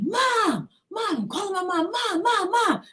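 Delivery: high calls for her mother; calling for mom. Retold or imitated. Girl pinned down calling her mother for help, high desperate calls.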